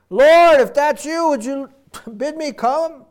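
Peter calling out high and loud; eager call. Retold or imitated. Peter calling to the Lord from the boat, eager and loud. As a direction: shout it across the water, half laughing.

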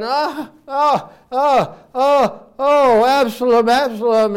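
high wailing lament over a son; mock weeping. A hint of David weeping over his son Absalom. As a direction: wail the name like a grieving father. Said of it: "David's, like, weeping and crying here".

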